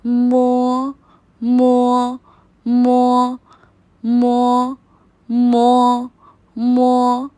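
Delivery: teacher drilling मो for repetition; Chinese syllable mo. Shown as a demonstration. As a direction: clear model मो. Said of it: "M".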